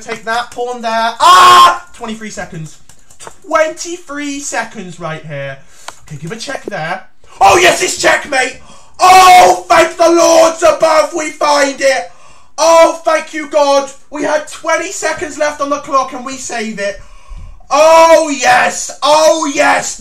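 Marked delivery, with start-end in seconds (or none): 1.2-1.9 loud high yelp of alarm; panic yelp. Real, not acted. Time pressure panic. 7.4-20.0 higher, louder cries of relief; relieved triumphant shout. Real, not acted. Finds checkmate with seconds left.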